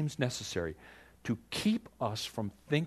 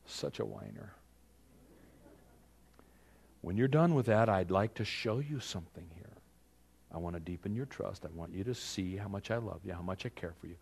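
gentle voice of God; 4 semitones lower, 5 dB quieter than usual. Retold or imitated. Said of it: "God says".

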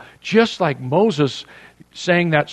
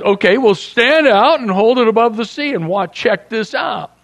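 much higher, hammed-up Yiddish-accented God; God with a Yiddish lilt; 8 semitones higher, 4 dB louder than usual. God scolding a whining Moses, hammed-up Yiddish lilt. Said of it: "That's how he talked".

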